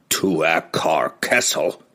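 slow, hollow, compelled answer; the words forced out against his will; entranced reply. A narrator's reading. King answering the wizard under a spell, slow and hollow. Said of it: "he responded, to his own repulsion".

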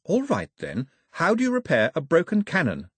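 lightly voiced character, lifting high on the question; Daphne's questioning voice. A narrator's reading. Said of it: "said Daphne".

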